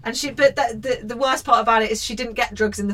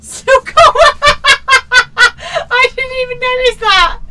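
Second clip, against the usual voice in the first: high, loud cackling through the words; real helpless laughter; 12 dB louder, slower than usual. Real, not acted. Host cracking up at the wedding ring, laughing. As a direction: squeal it out while cracking up.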